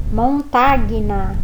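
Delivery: montagna said slowly, gn as nh; slow demo word. Shown as a demonstration. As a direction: montagna spelled out slowly, written form. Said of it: "se você vê escrito lá".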